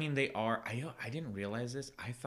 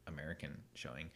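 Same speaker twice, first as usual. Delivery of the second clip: unvoiced embarrassed whisper; whispered aside; 6 semitones lower, 12 dB quieter than usual. Real, not acted. Admitting ignorance to a friend, whispered aside. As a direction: drop to a whisper for the confession. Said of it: "this just shows my".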